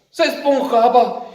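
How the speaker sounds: plain reading voice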